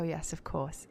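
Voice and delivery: ordinary delivery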